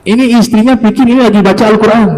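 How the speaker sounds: usual speaking voice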